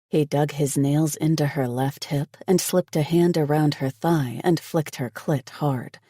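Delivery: ordinary delivery, nothing marked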